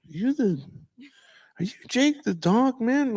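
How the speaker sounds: high, excited recognition; mock fan asking if he is Jake. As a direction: squeak it like a starstruck fan. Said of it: "people are like".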